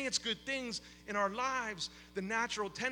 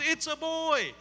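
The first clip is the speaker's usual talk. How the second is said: put-on raised, excited call of it's a boy; mock joyful shout; 8 semitones higher, 4 dB louder, slower than usual. Father retelling his parking-lot shout, mild mock yell. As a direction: recall the shout, only half-full. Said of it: "which is much louder than that".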